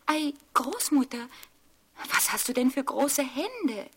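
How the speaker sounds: put-on a curious child asking; little girl voice. A narrator's reading. Rotkäppchen voice. (Rotkäppchen fragt erstaunt mit hoher Mädchenstimme)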